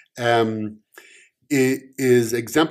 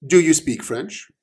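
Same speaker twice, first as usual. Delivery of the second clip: challenging question; 7 semitones higher, 5 dB louder than usual. Acted. Omen challenges cop.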